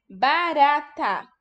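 "Barata" slow, in three even beats; syllable by syllable. Shown as a demonstration. Teacher asks how many parts it has, "Barata" slowly syllabled. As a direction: separate "Barata" into countable parts. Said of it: "Quantas partes tem?".

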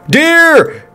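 sudden high-pitched yelp of alarm; startled cry. Real, not acted. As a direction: shout a warning as it jumps out. Startled by deer on the road.

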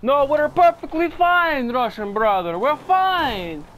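put-on high, loud, slow Russian-accented insistence; a Russian driver refusing to admit the crash. Telling his Russian brother they're fine in a Russian accent. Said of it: "back to Russian accent".

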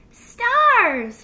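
very high, loud exclamation; gleeful little fox outburst; laughing delight, no laugh. A narrator's reading. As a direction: shout the discovery with glee. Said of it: "he laughed".

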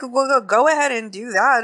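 high-pitched, daring retort; retold defiance. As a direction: imitate someone calling a bluff.

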